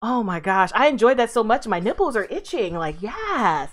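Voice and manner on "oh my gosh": ordinary talk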